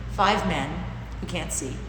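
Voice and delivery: usual speaking voice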